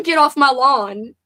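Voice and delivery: loud, slow mock grouch; grumpy old person. Streamer owning up to sounding old, mock grumpy. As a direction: grouse it slowly, hamming up the crankiness. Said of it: "you sound so old and grumpy".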